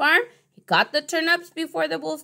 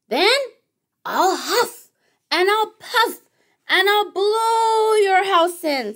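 wolf threat; 8 semitones higher, slower than usual. Acted.

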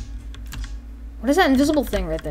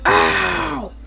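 high, drawn-out ow; pretend yelp of pain; 9 semitones higher than usual. Player voicing the boat's victim, high pained cry. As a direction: yelp as if struck. Character hit by boat.

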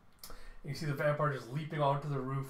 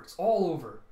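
louder despairing outburst; desperate cry; 3 semitones higher, 8 dB louder than usual. Retold or imitated. Wife panicking to her husband as the fleet falls, loud despair. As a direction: cry out that everything is lost.

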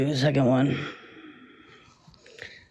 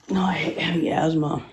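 unvoiced, winded; breathless whisper; faster than usual. Real, not acted. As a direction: whisper through lost breath. Winded after asthma flare.

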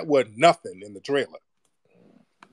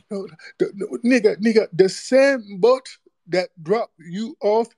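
mock pleading critic; 10 semitones higher than usual. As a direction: stretch the plea out high and mocking. Mocking the same-boat argument.

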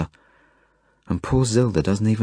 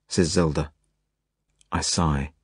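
usual speaking voice

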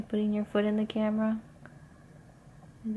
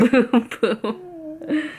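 high laughing race car boom; 9 semitones higher, 7 dB louder than usual. Real, not acted. Mother echoing toddler's race car noise, laughing.

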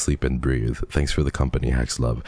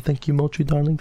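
cutesy coo; 11 semitones higher, faster than usual. Acted. Cooing thanks to chatter.

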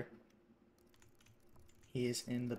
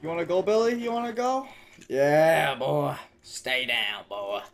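very loud challenge, starting high and dropping; shouted fight taunt; 3 semitones higher, 14 dB louder than usual. Pretend. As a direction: yell trash talk at the game enemy. Fighting Billy in the game.

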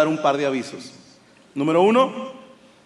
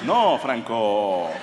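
slow, low, let-down "No, Franco"; disappointed friends; 6 semitones lower, much slower than usual. Retold or imitated. Friends turning down Franco, slow and disappointed. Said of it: "fue más así como decepcionados".

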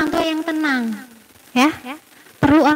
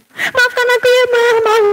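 put-on child apologizing; 8 semitones higher, 3 dB louder than usual.

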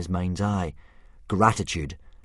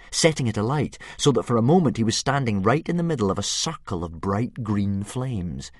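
ordinary delivery, nothing marked

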